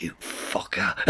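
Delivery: soft, voiceless mock curse; hiss-free whispered jab at a friend; whispered insult.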